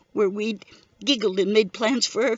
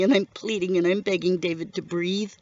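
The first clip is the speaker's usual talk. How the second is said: ordinary delivery, nothing marked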